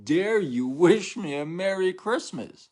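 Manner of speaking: high, loud, slow mock outrage; outraged offended person. Mocking an offended stranger, hammed-up high outrage. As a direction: play the scandalized victim of a holiday greeting. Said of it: "they take it so personal".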